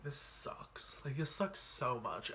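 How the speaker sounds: soft, slow whispered despair. Retold or imitated. Boy at his desk quietly despairing during the vote. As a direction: breathe it out, defeated.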